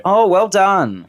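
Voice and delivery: high, drawn-out mock admiration; slow, swooping sarcastic approval; sarcastic praise. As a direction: lay the fake congratulations on thick. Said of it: "if they're really sarcastic".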